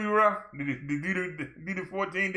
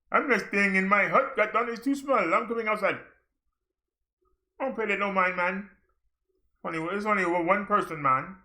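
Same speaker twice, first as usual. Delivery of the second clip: put-on raised-pitch, joking Jamaican accent; mock Jamaican accent; 3 semitones higher than usual. Villager in Africa refusing to stay in the hut, mock Jamaican accent. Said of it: "That's Jamaican accent".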